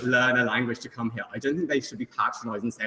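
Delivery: ordinary talk, nothing marked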